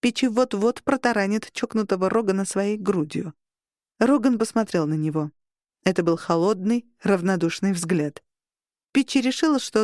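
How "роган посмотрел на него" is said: ordinary delivery, nothing marked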